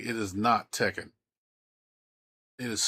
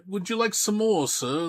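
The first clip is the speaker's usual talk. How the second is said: high, timid plea for more gruel; mock orphan begging for more; 11 semitones higher, 4 dB louder than usual. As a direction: play a meek workhouse boy holding out his bowl. Gruel scene, beggar boy asking.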